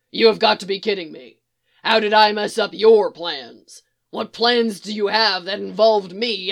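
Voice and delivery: loud, exasperated protest; indignant disbelief. A narrator's reading. Firebird disputing blame for ruined plans, loud and exasperated. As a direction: protest loudly, incredulous. Firebird, astonished, keeps arguing with Barry.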